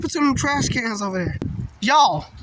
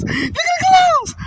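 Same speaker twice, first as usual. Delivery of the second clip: excited shout; 4 dB louder than usual. Real, not acted. Excited at clouds.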